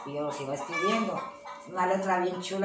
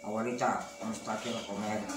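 deeper man's voice; 9 semitones lower, 6 dB quieter than usual. Retold or imitated. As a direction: voice dropped much lower, like a teen boy's. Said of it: "así, la voz más gruesa".

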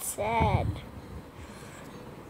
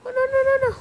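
much higher, loud panicked no no no; panicked shriek; 12 semitones higher, 9 dB louder, much faster than usual. Real, not acted. Gamer panicking as a snake traps her, shrieking.

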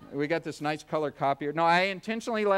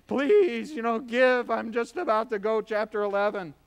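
higher, louder pleading street-corner beggar; mock panhandler plea, raised and loud; begging beggar; 4 semitones higher, 5 dB louder than usual. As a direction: hold your hand out and beg for change. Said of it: "this guy in raggedy clothes".

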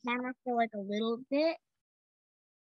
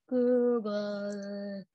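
lower, long drawn-out mysterious word; symbol's voice; 4 semitones lower than usual. Acted. Tyler reads a symbol in the sky, low drawn-out voice. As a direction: stretch the name out low and slow.

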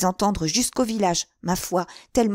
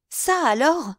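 higher startled exclamation; surprised cry; 7 semitones higher, slower than usual. A narrator's reading. Girl bumping into the narrator, crying out in surprise. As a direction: blurt it out in surprise. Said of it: "s'écria-t-elle".

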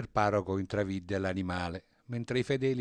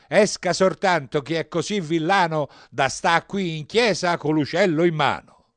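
exasperated high, loud tirade; shouting priest; 8 semitones higher, 11 dB louder than usual. A narrator's reading. Priest calling the nuns back inside, shouting. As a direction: holler it out to the whole church. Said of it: "allora gridò il prete".

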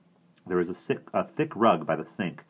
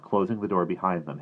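ordinary delivery, nothing marked

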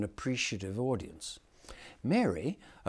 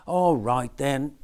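put-on children's nativity voices; 2 semitones higher, 7 dB louder, slower than usual. A narrator's reading. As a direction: play the squabbling Mary and angel. Mary agrees.

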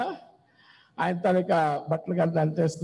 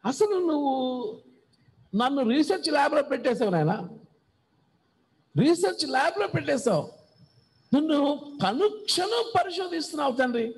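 lightly voiced psalmist; 5 semitones higher than usual. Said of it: "పాట నోటుకు వచ్చింది".

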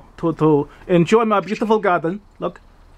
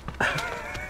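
squeaky whispered laugh; a tiny breathless giggle; high airy giggle; 12 dB quieter than usual. Real, not acted. Laughs as bat kisses gargoyle.

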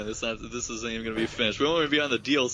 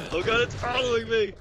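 mock panic; 11 semitones higher than usual. Chased by bee.

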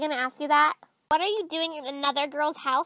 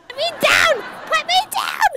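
high, loud shrieked demand; panicked yelling; 10 semitones higher, 7 dB louder than usual. Acted. To a friend lifting her, high panicked yelling. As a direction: shriek to be let go, climbing higher. Yelling while being lifted.

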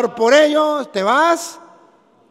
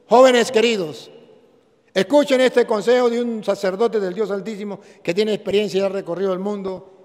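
ordinary talk

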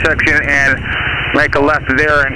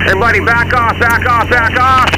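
shouting orders; 10 semitones higher than usual. Acted. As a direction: bark it out to the team.

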